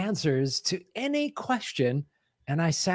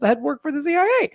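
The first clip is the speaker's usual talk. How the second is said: climbing into a very high squeak; high-pitched doubtful voice; faster than usual. Shown as a demonstration. Host hedging a conspiracy claim, high-pitched doubt. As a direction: let the pitch shoot up as the claim gets shakier. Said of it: "I just go to this voice".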